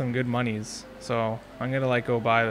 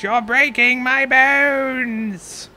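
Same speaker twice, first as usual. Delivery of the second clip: pained shriek; 11 semitones higher, 8 dB louder, much slower than usual. Acted. Pirate character crying out in pain.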